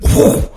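airy whoosh noise; sudden vocal sound effect; imitated whoosh. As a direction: make the rush of a bonnet flying up. Imitates bonnet flipping up.

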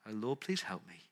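quoting a begging prayer, soft and slow. Retold or imitated. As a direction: plead with the Lord in near hush. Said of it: "simply beg".

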